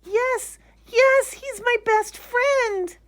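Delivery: put-on very high, loud, slow child; joyful shout. Lisa running to her found bear, overjoyed. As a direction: cry out with joy. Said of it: "shouted Lisa".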